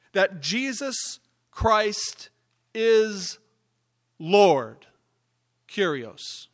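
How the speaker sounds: preaching the hymn aloud, building in force; scripture reading. Acted. Preacher proclaiming Philippians to the congregation, building in force.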